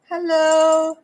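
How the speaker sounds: high, loud cat voice greeting; voicing the cat. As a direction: sing out hello as a proud cat. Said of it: "You're like".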